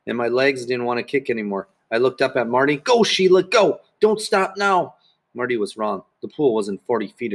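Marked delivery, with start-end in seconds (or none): 2.8-4.9 high, insistent cheering shout; urging yell. A narrator's reading. Marty urging tired Sheila across the pool, yelling. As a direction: shout her through the tiredness. Marty yells at the tiring swimmer.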